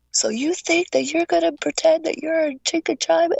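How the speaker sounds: drill instructor voice. Retold or imitated. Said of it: "he's going".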